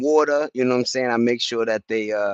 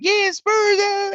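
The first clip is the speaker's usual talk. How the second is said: much higher mocking cheer of rival fans; slower than usual. Mocking Spurs fans' cheer, much higher hammed-up voice. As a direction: ham up the other fans' celebration. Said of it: "everybody was like".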